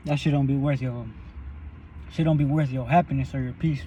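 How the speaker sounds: usual speaking voice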